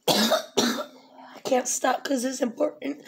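coughing fit, whispering through it; fighting for breath while speaking; asthma attack. Real, not acted. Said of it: "I will go into an asthma attack".

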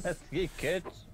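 put-on drawn-out grumble at meddling kids; foiled villain. Finishes Scooby-Doo villain line.

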